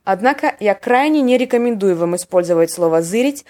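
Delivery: usual speaking voice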